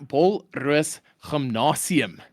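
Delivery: Afrikaans pronunciation, slow and deliberate; put-on Afrikaans accent, rolled out slowly. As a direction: lay on the Afrikaans accent, word by word. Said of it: "say it in that accent".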